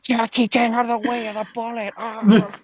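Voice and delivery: high, hammed-up yell to Jackie; hammed-up high warning voice; mock warning. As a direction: shriek the warning in a put-on voice.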